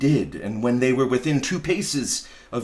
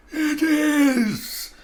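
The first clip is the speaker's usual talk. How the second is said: booming ghost; 13 semitones higher than usual. Acted. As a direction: proclaim it like a doomed ghost. Marley's ghost speaking.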